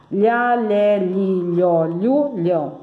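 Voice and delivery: lhá, lé, li, lhó, lhu, lhão drawn out in a connected run; syllable drill. Shown as a demonstration. Teacher leading pupils in repetition, drawn-out syllable recitation. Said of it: "vamos repetindo aqui".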